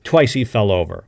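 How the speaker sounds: ordinary delivery, nothing marked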